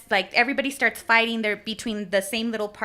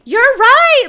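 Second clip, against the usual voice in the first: sudden realization; 14 semitones higher, 13 dB louder than usual. Retold or imitated.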